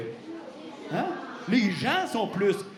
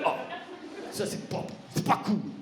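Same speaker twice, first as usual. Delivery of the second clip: sulky; 4 dB quieter, faster than usual. Acted. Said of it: "il a boule".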